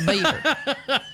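laughing impression of a singer's word. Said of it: "said it how [name] would say it".

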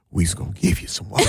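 low, quick plantation imitation; mock slave-message voice. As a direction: imitate it low and hurried, folksy. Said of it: "you said that like a slave message".

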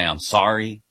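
low, mock French accent, badly faked; terrible French accent. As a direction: put on a lightly done bad French accent. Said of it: "I'll do this terrible accent".

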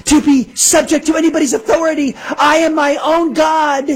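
high-pitched mimicking whine; mock meltdown. Radio host mimicking the person just heard in the clip, mocking meltdown.

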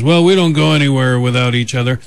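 hammed-up Scottish lilt, playing the defendant; Scottish accent. Radio host quoting the Scottish defendant, in a mock Scottish accent. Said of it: "That's my Scottish accent".

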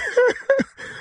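high-pitched cackling laugh; delighted laugh. Real, not acted. Prankster laughs at victim.

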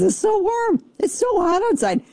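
high-pitched comic complaint; exasperated whine. Real, not acted. Host griping to listeners about the heat, exasperated. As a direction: wail about it, hammed up.

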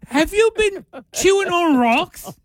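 high, slow hammed-up British; mock British accent. Hospital staff quiz a patient, mock British accent. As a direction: put on a high-pitched British voice.

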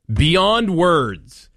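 sarcastic, pitched high and overdone; sarcastic, exaggerated. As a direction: play up fake amazement, sarcastically. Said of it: "My sarcasm is deep".

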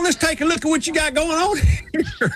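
hammed-up Southern brain surgeon. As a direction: lay on a thick Southern doctor voice. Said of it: "he goes, 'Well".

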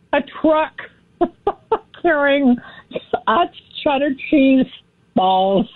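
halting delivery broken by chuckles; laughing at own joke. Real, not acted. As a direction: try to get the words out between laughs. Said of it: "don't laugh at your own joke".

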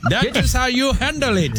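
put-on high, proud German accent. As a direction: declare it like a stern German. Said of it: "do it like the Germans do".

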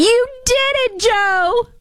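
very high, gushing mock wife voice; Jill impression. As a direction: coo proudly like a doting wife.

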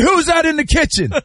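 high-pitched mock challenge, half laughing; imitated suspicious dad. Dad hearing someone in the kitchen, high mock challenge. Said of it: "I bet you your dad was like".

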